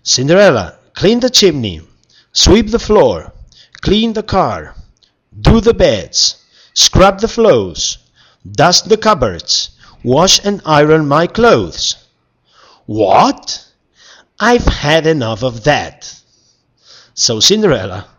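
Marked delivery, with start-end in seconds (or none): 0.0-12.0 high, loud demanding list; bossy orders. Acted. Prince now ordering her around. 12.9-16.2 fed up. Acted. Cinderella fed up.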